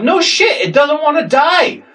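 high-pitched acted exasperation; exasperated retort. Skunk holder answers the cop's excuse, exasperated. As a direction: fire back in high-pitched disbelief.

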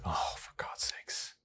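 breathy muttered sigh of disbelief; hushed, weary groan of a line; exasperated whisper. Retold or imitated. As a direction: whisper it, fed up and resigned. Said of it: "I was just like".

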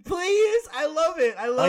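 high, loud begging; excited pleading. Real, not acted. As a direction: plead with giddy enthusiasm. Begging friend to do accent.

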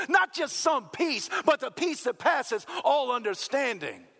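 punchy rhythmic contrasts, climbing in pitch; building preacher fervour. Real, not acted. Preacher listing what God gives, building fervour.

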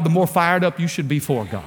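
normal talking voice

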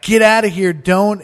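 high, loud "Get outta here"; shooing shout. Retold or imitated. Bandmate shooing the friend away, loud. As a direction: bark him out of the room. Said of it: "He's like".

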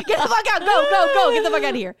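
high, loud, laughing shoo-off; laughing mock yell. Doorman shooing guests out, laughing mock yell. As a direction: bark them out the door through laughter. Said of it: "And I was like".

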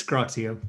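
GROXIO pronounced GROCK-see-oh; "Groxio" ending in "ee-oh"; Groxio as one word. Shown as a demonstration. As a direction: give GROXIO as one blended name, not spelt letters. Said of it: "How do you say it?".

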